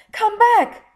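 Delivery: very high, very loud furious yell; angry shout. Acted. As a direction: bellow after the vanishing kids. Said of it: "Buncombe shouted angrily".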